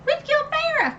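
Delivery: very high, panicked exclamation; freaking out. Retold or imitated. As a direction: yelp in shock at the twist. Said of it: "So I'm, like, freaking out".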